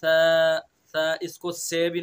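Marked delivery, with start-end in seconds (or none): 0.0-0.6 "स" short and loud, soft hiss. Shown as a demonstration. Teacher drilling the letter, loud clear demo. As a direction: say "स" gently from the teeth edge. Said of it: "इससे सा अदा होगा नरमी के साथ".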